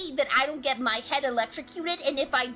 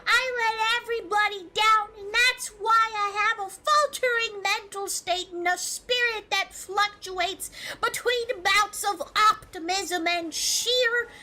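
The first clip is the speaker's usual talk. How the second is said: mock crying; 8 semitones higher than usual. Continues mock crying rant.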